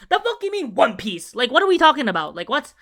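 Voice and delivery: loud, high exasperated rant; indignant outburst. Retold or imitated. Ranting at the One Piece poster, loud and indignant. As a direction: fire it back at them, offended.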